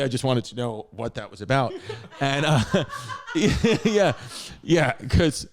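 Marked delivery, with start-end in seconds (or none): 2.9-3.8 real laugh. Real, not acted. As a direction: laugh at the memory. Laughing at own story.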